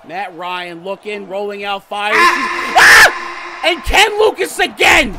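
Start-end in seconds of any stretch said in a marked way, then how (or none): 2.2-3.7 high, piercing shriek; startled scream. Real, not acted. Gamer startled mid-play, high scream. 3.7-5.2 loud, high outburst; frantic commentator shout. Real, not acted. Commentator calling Lucas again, frantic shout.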